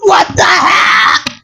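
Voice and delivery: fed-up outcry; exasperated shout. Real, not acted. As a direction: throw up your hands and shout it. Exasperated outburst.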